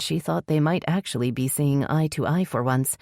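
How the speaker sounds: plain reading voice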